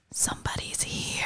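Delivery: hushed, whispery mock warning; whispered dog voice. Voicing her dog at the door.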